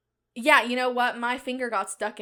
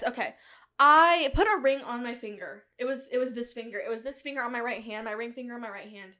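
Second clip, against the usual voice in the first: usual speaking voice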